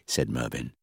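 usual speaking voice

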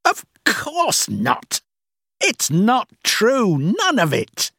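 very high, slow, drawn-out exclamation; scornful outburst. A narrator's reading. Boss admitting to Rachel it is untrue, scornful. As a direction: stretch each denial in exasperation.

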